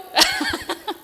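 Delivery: a quick high-pitched laugh breaking through tears; embarrassed little laugh; short high laugh. Real, not acted. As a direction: laugh at yourself mid-story. Laughing at her own tears.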